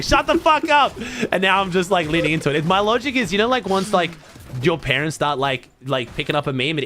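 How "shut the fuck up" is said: retold yell, much higher and a bit louder; half-joking fed-up shout; mock outburst. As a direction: snap it out like you're fed up with the meme. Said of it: "I was like".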